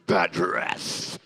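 very low, slow drawn word; relishing the funny word; deep savoring. Acted. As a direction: drop into a low rumble.

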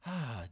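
long, drawn-out sighing "ah"; demonstrated sigh of release; relieved deep breath. Shown as a demonstration. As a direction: let out a big breath of relief. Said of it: "deep breath".

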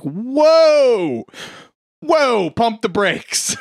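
high, drawn-out mock cry of alarm; alarmed whoa. Reacting to a man blowing his head off, mock alarm. [name] reacts to gunshot in retelling.

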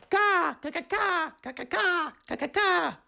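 high bird caws; screeching birds. A narrator's reading. Birds calling over the river, high screeching caws. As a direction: caw like a flock of birds. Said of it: "birds screeched".

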